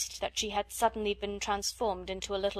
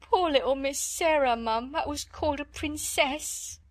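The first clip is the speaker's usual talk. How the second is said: higher, louder sobbing maid voice; breaking into tears; 6 semitones higher, 3 dB louder than usual. A narrator's reading. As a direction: break down crying on poor little. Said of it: "breaking out afresh".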